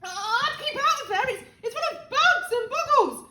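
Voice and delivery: high, loud, nervous caution; called-out warning. A narrator's reading. George warning of bugs in the attic, calling out. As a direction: call up a worried warning. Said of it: "George called out".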